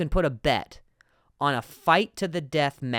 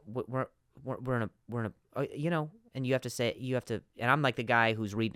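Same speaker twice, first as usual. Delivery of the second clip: ordinary talk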